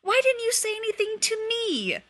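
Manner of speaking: high, loud accusing cry; shouted demand. A narrator's reading. Remus, arguing in the bath, cries out loudly at Sirius. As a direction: hurl the question at him, hurt and loud.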